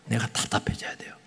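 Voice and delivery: hushed confiding whisper. Real, not acted. Pastor confiding to the congregation in a whisper. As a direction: breathe it like a confession.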